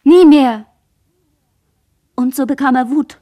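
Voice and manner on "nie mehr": outcry. Acted. Girl cries out in memory.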